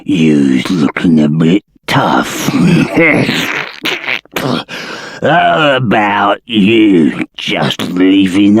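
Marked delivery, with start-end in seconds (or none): 2.5-5.2 troll chuckle. Acted. Troll mocks the tough visitor.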